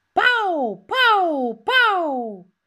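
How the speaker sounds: loud, high pauw pauw pauw; shrill-free peacock cry, high and loud; peacock call. Retold or imitated.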